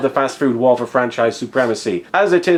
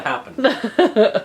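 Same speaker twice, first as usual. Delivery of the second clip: laughing; 8 semitones higher than usual. Real, not acted. Slow laughing punchline.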